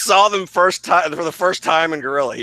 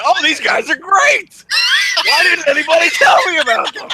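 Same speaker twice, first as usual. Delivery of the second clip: mock gushing fan; 13 semitones higher, 5 dB louder than usual. Mocking his friend as a late fan, high loud gushing. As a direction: ham up a late convert's gushing. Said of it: "He is not, like".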